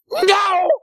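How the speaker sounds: high, loud mock-wife yelp; imitated cross wife. Wife answering her cautious husband, hammed-up angry denial. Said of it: "the brisk, stilted way she's moving".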